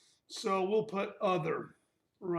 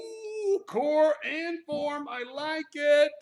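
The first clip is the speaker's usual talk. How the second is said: higher, louder, slow delight; 8 semitones higher, 7 dB louder, much slower than usual. Real, not acted. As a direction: sound thrilled with the idea. Delighted at her suggestion.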